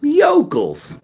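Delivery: yokels said high and drawn out with a falling end; a high, mocking yokels; yokels, high pitched and mocking. Shown as a demonstration. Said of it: "Let's call them that".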